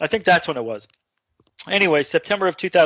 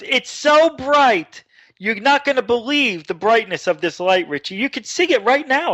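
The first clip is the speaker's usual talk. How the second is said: excited mother; 7 semitones higher than usual. Retold or imitated. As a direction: gush in a raised voice, imitating an astonished mother. Mother marvelling at the light.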